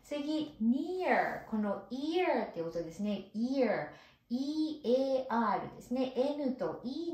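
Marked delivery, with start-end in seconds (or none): none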